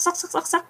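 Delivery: sắc said high and soft, each one rising; repeated sharp rising tone. Shown as a demonstration. Mimicking how Vietnamese keep rising, each sắc high and soft. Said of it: "Còn Việt Nam mình thì thường là cứ sắc".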